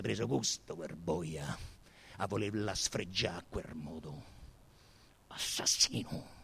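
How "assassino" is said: whispered accusation. A narrator's reading. Narrator condemns the killer.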